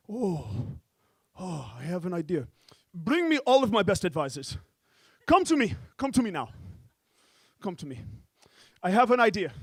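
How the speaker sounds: lightly voiced commanding sheik; a hint of a grand oil sheik. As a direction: summon advisors like a lordly sheik. Comedian voices sheik summoning advisors.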